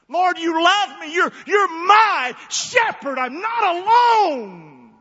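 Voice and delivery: high, loud, drawn-out cry to God. Shown as a demonstration. Preacher crying to the Lord amid life's stress, full voice. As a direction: belt it out slowly to heaven. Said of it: "talk like that in your life".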